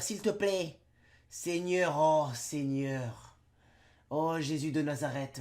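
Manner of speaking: slow hammed-up pleading prayer; mock prayer. Youtuber praying to be rid of his cold, slow mock prayer. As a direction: beg heaven for relief, theatrically slow.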